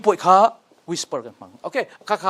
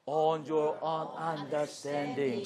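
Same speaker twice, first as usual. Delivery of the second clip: much softer and slow; 4 semitones lower, 11 dB quieter, slower than usual. Shown as a demonstration. Teacher modelling the verse for students, soft voice. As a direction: ease right down, gentle and slow. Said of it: "Soft voice".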